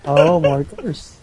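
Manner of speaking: mock agreement. Echoing mockingly.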